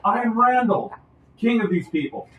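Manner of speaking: high, loud royal announcement; king's proclamation. Acted. Crowned leader naming himself to strangers, high proclamation. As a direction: proclaim your name and title high and loud. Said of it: "he goes".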